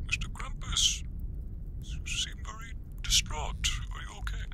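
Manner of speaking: put-on very high, soft whispered old man; a tiny, far-off, fussing whisper; Kris Kringle voice. Kringle on the phone asking Krampus if he is okay, very high soft whisper.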